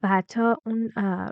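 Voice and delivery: ordinary talk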